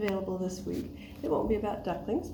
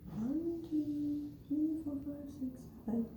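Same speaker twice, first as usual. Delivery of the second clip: soft, high little count; hushed counting; 7 semitones higher, 5 dB quieter than usual. Pretend. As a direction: count under your breath, as if searching.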